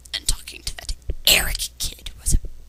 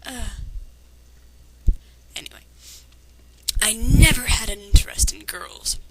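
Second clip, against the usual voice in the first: ordinary talk, nothing marked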